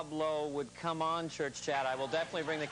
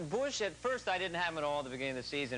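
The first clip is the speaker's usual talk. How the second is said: ordinary talk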